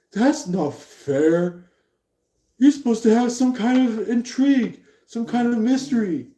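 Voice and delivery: high-pitched complaint, acted out; mock protest. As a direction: protest like someone cheated of their surprise. Acted protest in retold exchange.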